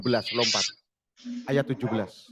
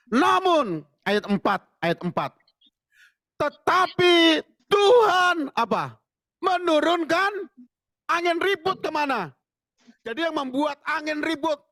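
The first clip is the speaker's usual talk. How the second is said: high, urgent preaching voice; fervent preaching; 9 semitones higher than usual. Acted. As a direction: drive the Bible story home to the crowd. Preaches the Jonah passage.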